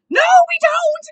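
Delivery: very loud, high shrieked retort; outraged shout. Acted. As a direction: shout down the other person, indignant. Acting out the character's angry retort.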